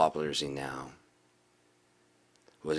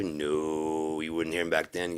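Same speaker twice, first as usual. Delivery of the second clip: ordinary delivery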